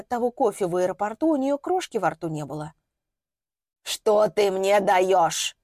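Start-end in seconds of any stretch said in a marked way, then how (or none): 3.8-5.6 put-on very slow, wheezed old crone; hoarse wheeze. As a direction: rasp it out in scorn. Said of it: "прохрипела старушенция".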